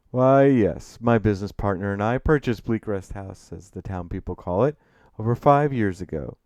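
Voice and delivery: put-on proud, refined older gentleman; Gabriel Jayfield. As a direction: open loud and delighted, then settle into formality. Narrator voices Gabriel.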